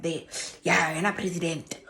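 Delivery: whispered, half to herself; whisper aside. Retold or imitated. Angry letter writer whispering her retort. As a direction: mutter it under the breath.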